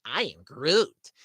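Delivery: slow drawn-out Groot. Retold or imitated. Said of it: "All he can say is".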